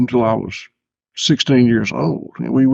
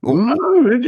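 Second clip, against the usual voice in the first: ordinary talk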